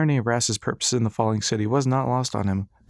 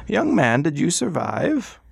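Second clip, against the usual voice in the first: put-on higher, slow, curious old gentleman; old doctor; 7 semitones higher, 3 dB louder, slower than usual. Old doctor calling down to Ras, high and slow. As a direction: peer down at him with amused concern.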